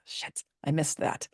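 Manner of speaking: hushed whisper under the breath; a quick whisper so he won't hear; whispered curse. Real, not acted. Wife caught dialing as husband walks in, whispered.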